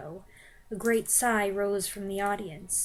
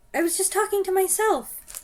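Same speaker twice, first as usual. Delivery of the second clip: higher, more pleading cry; redone frightened plea; 10 semitones higher, 5 dB louder than usual. Shown as a demonstration. Front-row witch retaking her plea with more fear. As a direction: try it again more desperately. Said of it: "That's better".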